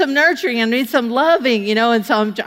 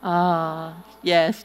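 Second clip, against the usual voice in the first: low soft sigh of approval; swooning women; 6 semitones lower, 5 dB quieter than usual. Retold or imitated. Women coworkers swooning over the story, low and soft. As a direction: breathe out a dreamy ah yes. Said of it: "just like that".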